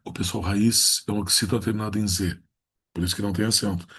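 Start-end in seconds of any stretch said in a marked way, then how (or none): none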